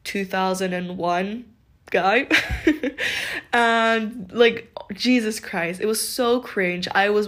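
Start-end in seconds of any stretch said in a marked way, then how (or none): 2.3-3.5 a high breathy laugh; airy laugh. Real, not acted. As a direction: laugh through your breath, rising. Laughs about younger guy.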